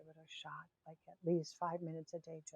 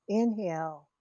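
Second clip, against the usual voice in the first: firm cues, counts fading to a near whisper; guided breathing count; much slower than usual. Acted. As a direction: pace the breath slowly, letting each number fade. Leads a counted breathing exercise.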